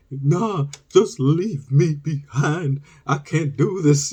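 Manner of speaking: high, slow, melodramatic surrender; hammed-up tearful self-sacrifice; mock teary-eyed pleading. Exhausted kid to his cousin, mock tearful plea. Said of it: "we got teary-eyed".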